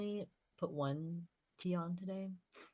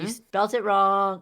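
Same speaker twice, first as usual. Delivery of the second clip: loud, slow teasing call; 5 semitones higher, 14 dB louder, slower than usual. Real, not acted.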